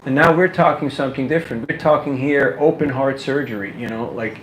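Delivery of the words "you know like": normal talking voice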